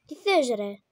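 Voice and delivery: very loud outburst; exasperated shout. Real, not acted. Player demands what baby wants, exasperated shout.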